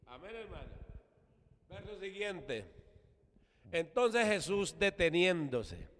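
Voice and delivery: normal talking voice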